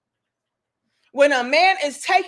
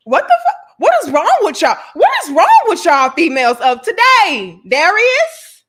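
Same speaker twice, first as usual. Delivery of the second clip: louder, fast, fed-up ranting; exasperated yelling; 8 dB louder, faster than usual. Real, not acted. Ranting at women of today, loud exasperated yelling. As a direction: shout your frustration at them.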